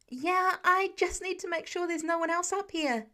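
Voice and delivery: calling back. Acted. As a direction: call back over the noise. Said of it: "Peter calls back".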